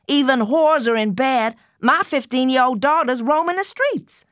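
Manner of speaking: high-pitched scolding rant; shouting mother. A narrator's reading. Mother shouts at her teenage daughter for coming home late, high and angry. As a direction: rage at the runaway daughter. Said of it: "She was cursing and shouting".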